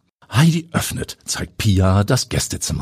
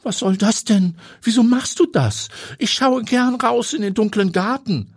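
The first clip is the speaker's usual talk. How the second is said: higher voiced complaint, mildly indignant; complaining. A narrator's reading. Heidi objects to her friend closing the curtains, mild complaint. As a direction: protest with a light sulk. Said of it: "beschwert sich Heidi".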